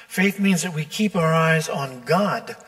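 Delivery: normal talking voice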